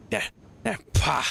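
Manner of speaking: three mock spits; acted spitting noises. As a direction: spit in disgust, ending on pah. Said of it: "Mebeth spits once, twice, three times".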